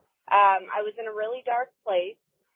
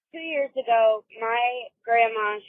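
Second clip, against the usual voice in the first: ordinary talk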